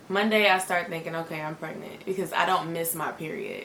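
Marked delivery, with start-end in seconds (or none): 1.1-2.0 a soft, stunned thought to herself; barely voiced, quietly taking it in; hushed inner thought. Missed period, she quietly tells herself she's pregnant.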